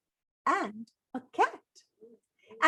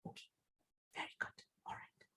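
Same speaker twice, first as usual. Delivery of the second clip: hushed whisper; soft whispered aside; 23 dB quieter, faster than usual. Real, not acted. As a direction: drop to a whisper for the little one.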